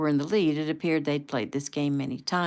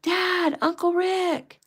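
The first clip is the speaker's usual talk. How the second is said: very high, loud scream; little boy screaming for his father; child's scream; 14 semitones higher, 6 dB louder, much slower than usual. A narrator's reading. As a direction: scream for Dad in terror. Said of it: "Little Joey screamed".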